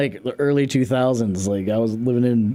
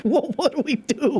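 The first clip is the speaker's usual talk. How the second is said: mock helpless; 12 semitones higher than usual. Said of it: "I was like".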